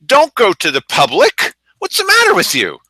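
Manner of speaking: high, incredulous mock rebuke, the last line shooting up; sarcastic impression of a scolding group leader. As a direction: scoff at them as if they're fools. Mocking the group's advice.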